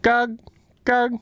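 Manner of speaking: high monotone squeak, evenly spaced; short, high-pitched repeated squeaks; playful high chirp. Pretend. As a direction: chirp each word on the same high note. Echoing the game's clicker sound.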